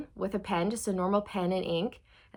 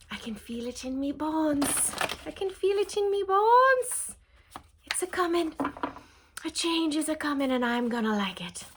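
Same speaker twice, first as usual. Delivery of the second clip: hammed-up, higher-pitched Irish accent; sad Irish accent; 7 semitones higher than usual. Sensing a change coming, badly faked Irish accent. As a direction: put on a bad Irish brogue, pitched up. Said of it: "That was my sad Irish accent".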